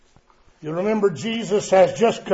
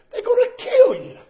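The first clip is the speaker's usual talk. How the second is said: 1 frightened disciples; 4 dB louder, faster than usual. Retold or imitated. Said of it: "The disciples say".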